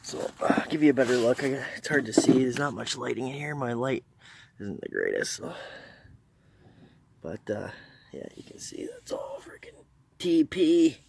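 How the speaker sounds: muffled behind a gas mask; talking through a respirator. Real, not acted. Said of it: "I got my gas mask on".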